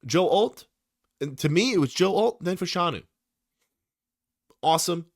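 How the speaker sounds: excited. Real, not acted. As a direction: rattle them off with enthusiasm. Excited naming of top tackles.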